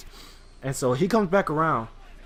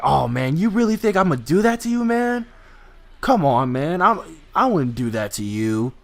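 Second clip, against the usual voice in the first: mock pleading stranger; 3 semitones higher, 4 dB louder than usual. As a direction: play the stranger acting offended. Stranger protests being distrusted.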